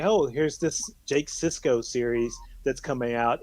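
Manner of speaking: ordinary delivery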